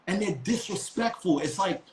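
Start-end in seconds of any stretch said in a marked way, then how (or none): none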